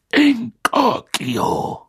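high, stretched-out casting cry; spell incantation. A narrator's reading. Teacher casting on the spider, drawn-out high incantation. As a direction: draw the spell out, high and slow. Moody casts enlarging spell.

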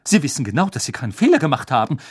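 loud, fast indignant reply; upset. Acted. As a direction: defend him heatedly.

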